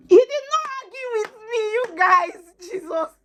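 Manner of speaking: high, loud disbelieving exclamation; astonished, pitched way high; real amazement. Real, not acted. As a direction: marvel at it, voice shooting right up. Amazed he agreed without arguing.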